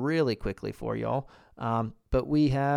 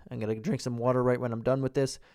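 ordinary talk

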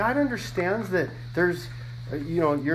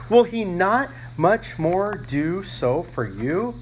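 raised scripture reading; 3 semitones higher, 5 dB louder than usual. Acted. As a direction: preach the verse out with force. Reads verse aloud, raised.

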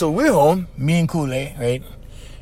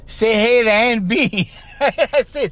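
laughing; 5 semitones higher, slower than usual. Retold or imitated.

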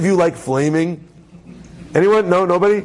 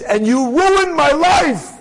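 high, slow, dramatic accusation; hammed-up anguished blame; accusing outburst; 8 semitones higher, slower than usual. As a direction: throw the blame at him, pitched high. Woman accuses rabbi in retold quarrel.

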